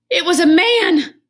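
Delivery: high, loud burst of surprise; spring the twist on the listener; surprised reveal. A narrator's reading. Narrator revealing the thing, loud and high.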